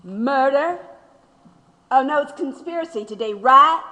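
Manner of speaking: mock surprise, quick and scornful; scornful rising jab; sarcastic mockery. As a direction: throw the charge back at him. Prisoner mocking the charge.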